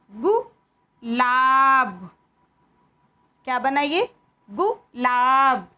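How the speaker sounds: कुछ, खुश, गुलाब spelled out slowly; short ऊ words. Shown as a demonstration. Teacher sounding out short ऊ words for children. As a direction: sound out each word carefully. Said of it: "इसको बोले कैसे जाएंगे".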